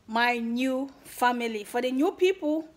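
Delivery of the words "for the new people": usual speaking voice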